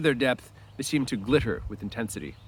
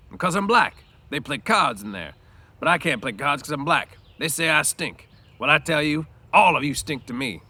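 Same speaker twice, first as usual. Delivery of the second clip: louder, higher bitter outburst; bitter Crooks; 4 semitones higher, 6 dB louder than usual. A narrator's reading. Crooks bitterly explaining to Lenny why he is shut out. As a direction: spit out the injustice.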